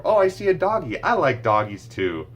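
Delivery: put-on playful modelling for a toddler; excited parentese. Shown as a demonstration. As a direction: point out the dog with glee. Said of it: "you can, like, see something".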